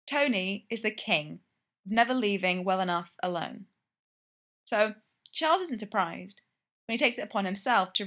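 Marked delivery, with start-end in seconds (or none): none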